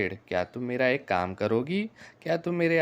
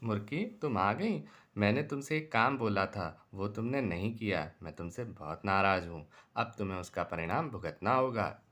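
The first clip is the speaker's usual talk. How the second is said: low, soft, menacing growl of a displeased mound; a grim warning to the selfish hen, low and cold; stern angry threat; 5 semitones lower, 6 dB quieter than usual. Acted.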